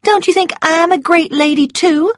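high coquettish voice. A narrator's reading. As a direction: coax him like a spoiled belle. Scarlet fishing for compliment.

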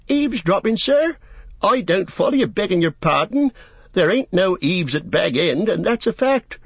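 high-pitched gardener protesting; indignant innocent Sam. Acted. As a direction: deny it all in a high voice.

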